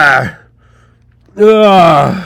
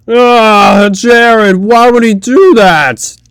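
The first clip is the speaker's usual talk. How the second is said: ordinary talk, nothing marked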